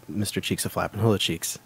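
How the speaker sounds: usual speaking voice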